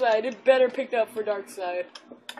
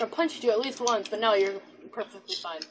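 ordinary talk

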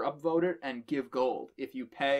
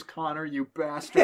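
mock outrage; 11 dB louder than usual. Real, not acted. Mock curse at old RA.

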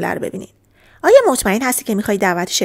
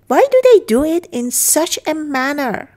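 higher model sentence; 6 semitones higher, 4 dB louder, slower than usual. Shown as a demonstration. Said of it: "teacher models English line".

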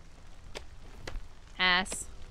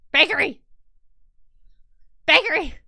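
loud frustrated yell for the bakery; begging yell at the chat; shouting; 5 semitones higher, 10 dB louder than usual. Real, not acted. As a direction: shout it out in protest. Yelling for bakery vote.

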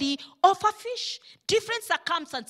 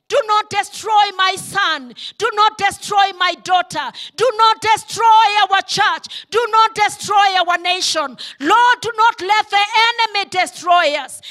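loud, higher, insistent pleading; shouted prayer; 4 semitones higher, 7 dB louder than usual. Acted. Preacher leads the congregation's prayer, shouting each plea. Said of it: "can we cry out today and say".

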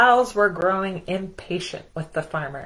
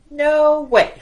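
loud, higher "no way"; firm refusal; 9 semitones higher, 8 dB louder than usual. Acted. Farmer refusing the cows, loud and firm. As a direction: shut the cows down flat. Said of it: "said the farmer".

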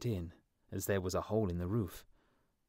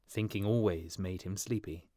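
plain reading voice

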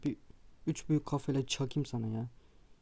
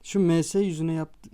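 louder and higher, heated; heated outburst; 4 semitones higher, 6 dB louder than usual. Real, not acted. Gamer ranting about the opponent, heated outburst. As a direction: rant at the screen.

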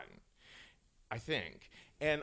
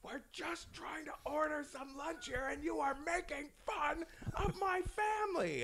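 high, strained yell held down in volume; half-whispered mock outrage; really angry; 14 semitones higher, 4 dB quieter than usual. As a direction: act out an exasperated customer's muted scream. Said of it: "really angry. And he's like".

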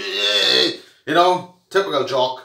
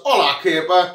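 normal talking voice

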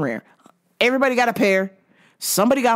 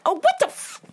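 sudden much higher indignant cry, ending in a hissed bitch; fake-offended squeal; mock outrage; 12 semitones higher, slower than usual. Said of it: "I'm like".

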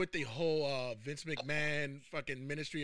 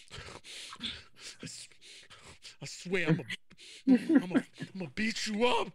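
little brother bluff; 2 semitones higher than usual. Retold or imitated. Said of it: "he gives you that face like".

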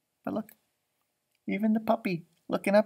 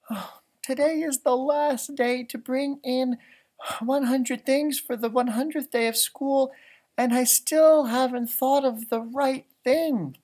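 put-on higher, slow, a touch of fretting child; lightly voiced worried girl; 7 semitones higher, 5 dB louder than usual. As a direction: read Jessica's worry gently higher. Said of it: "she said".

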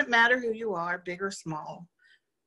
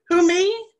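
high, loud, delighted Who, me?; happy surprise; 11 semitones higher, 8 dB louder than usual. A narrator's reading. Faith answering Misery's challenge, high and happy surprise. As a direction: burst out gleefully, as if caught off guard. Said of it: "And Faith happily proclaimed".

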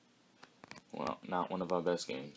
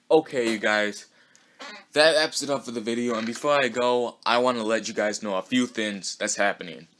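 louder, lifted sign-off voice; YouTuber outro; 4 semitones higher, 11 dB louder than usual. Real, not acted. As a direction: wrap up the video loudly for the viewers. Video sign-off to viewers.

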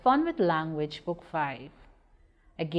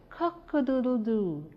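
crowing cock-a-doodle-doo; rooster crow; 5 semitones higher than usual. Acted. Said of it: "Rudy the rooster shouted".

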